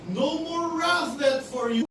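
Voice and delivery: loud, high, slow proclamation; full-force preaching. Acted. Preacher proclaiming to the church that wrath is gone, loud and high. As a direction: proclaim it as the climax.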